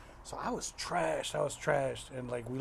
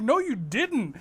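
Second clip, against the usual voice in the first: mock insult; 8 semitones higher, 4 dB louder than usual. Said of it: "they're like".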